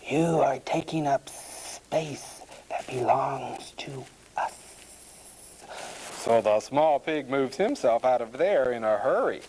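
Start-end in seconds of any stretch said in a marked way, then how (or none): 0.1-4.5 slow whispered snake voice; big snake. A narrator's reading. Big snake to the intruding pig, whispering. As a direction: whisper it slowly, stretching each s. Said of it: "says a big snake".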